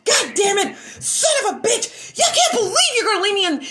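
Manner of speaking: high, loud whining imitation; mock whiny stalker. Mocking the stalker cursing at being dumped, high whine. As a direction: whine and curse like a sore loser.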